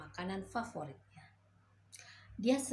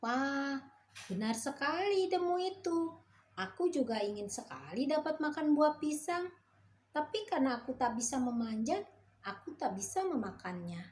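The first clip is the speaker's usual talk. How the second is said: much higher, excited good-natured frog voice; the kind frog, glad about the banana plan; eager frog; 6 semitones higher than usual. A narrator's reading. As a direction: chirp it out gladly, then settle down.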